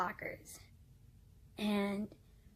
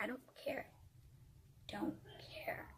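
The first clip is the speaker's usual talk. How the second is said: very soft, whispered brush-off; hushed dismissal; 4 semitones higher, 14 dB quieter than usual. Retold or imitated. Answering the boyfriend's excuse, hushed and dismissive. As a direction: drop to a whisper to shut him down.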